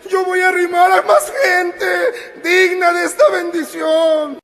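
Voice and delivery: high, loud cry from the pulpit; impassioned preaching. Acted. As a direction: cry out to the congregation, drawing out phrase endings. Impassioned preaching at full force. (predicación ferviente, a gritos)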